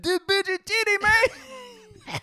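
very high mock Spanish accent; huge Spanish accent. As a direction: squeal it in a hammed-up Spanish accent. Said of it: "he just has a huge Spanish accent".

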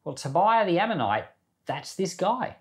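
usual speaking voice

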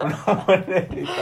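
amused laughing echo. Real, not acted. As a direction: giggle it out. Laughing repeat of joke.

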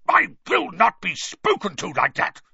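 barely controlled fury at being answered back; trembling with rage. A narrator's reading. Uncle refusing Harry's cheek, shaking with rage. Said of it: "trembling with rage".

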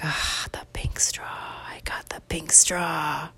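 slow, hushed whisper of triumph; awed whisper. Acted. Boy alone in the pantry at night, awed secret whisper. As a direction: whisper it slowly, savoring the prize.

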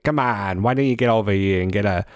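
hammed-up Boston local; mock Boston street talk; Boston accent. Shown as a demonstration. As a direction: do a pushy Bostonian, accent first. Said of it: "Boston people, they say something like".

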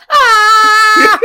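long, loud, high cartoon scream; mock scream. Cartoon monster told he's going to jail, mock scream. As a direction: scream in put-on cartoon panic.

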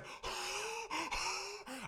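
long high awestruck gasp; breath taken away. Retold or imitated. In Michelle's hug, a long high awestruck gasp. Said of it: "I'm just like".

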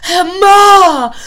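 high, loud alarmed yell; shouting a name. Retold or imitated. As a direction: call out her name in alarm. Acquaintance shouting her name.

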